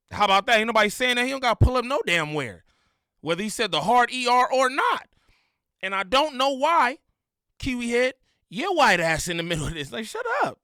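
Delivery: loud, heated telling-off, pitched high; fed-up, forceful rant; indignant rebuke. Real, not acted. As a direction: lay into them, loud and indignant. Heated rebuke to another commentator.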